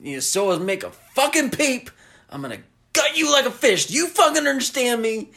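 hammed-up psycho threat; Otis threat. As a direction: rattle off the killer's threat, rising. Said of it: "he's like".

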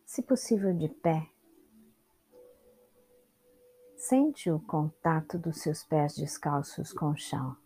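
unhurried meditation guide with long pauses; calm instructions in short, spaced-out phrases; guided meditation. Real, not acted. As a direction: guide them through the body, leaving space after each instruction.

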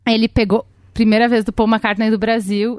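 ordinary talk, nothing marked

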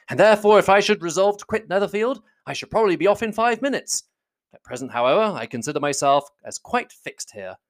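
reply with breezy assurance; lightly acted, animated young man; genial gentleman. A narrator's reading. Bingley replies about staying.

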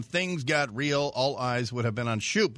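ordinary delivery

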